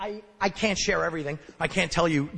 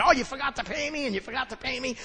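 lying paperboy; 7 semitones higher, 5 dB quieter than usual. Retold or imitated. Young paperboy lying to customers.

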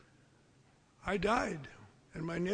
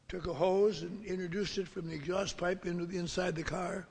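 ordinary delivery

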